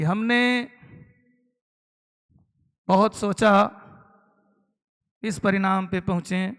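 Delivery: long dramatic pauses between phrases; mild imitation with drawn-out gaps; slow orator's pauses, lightly imitated; Atal Bihari Vajpayee style. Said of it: "Atal Bihari Vajpayee साहब की तरह बोले".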